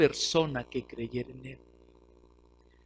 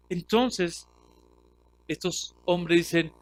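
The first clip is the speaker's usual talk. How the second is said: normal talking voice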